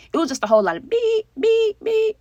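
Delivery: high, loud beep sound effect; bleep imitation. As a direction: make a censor bleep. Censoring the insults.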